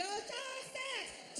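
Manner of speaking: "Just next" squawked high as one mashed word; mimicked lazy "Just next", squeezed and high; slurred counter call. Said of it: "she was barely trying to speak".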